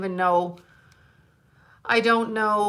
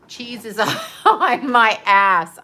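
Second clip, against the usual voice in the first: laughing through the punchline, slow and louder; 3 semitones higher, 7 dB louder, slower than usual. Real, not acted. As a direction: giggle it out as you repeat the joke. Joke repeated, laughing through it.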